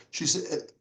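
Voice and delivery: normal talking voice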